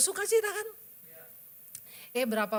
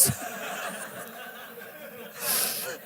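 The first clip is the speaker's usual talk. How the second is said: laugh; 7 dB quieter than usual. Real, not acted. Laughing at own story.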